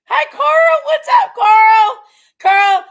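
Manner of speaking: loud, high, sing-out greeting; calling out. Real, not acted. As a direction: holler hello across to him, stretching the words.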